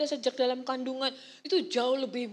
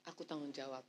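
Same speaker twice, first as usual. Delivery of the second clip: lower, much softer, steady proud declaration; proud father; 11 semitones lower, 8 dB quieter than usual. Retold or imitated. Father proudly taking responsibility, low soft steady voice. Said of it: "kebanggaan sebagai".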